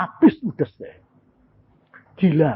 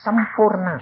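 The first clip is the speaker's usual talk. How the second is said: ordinary talk, nothing marked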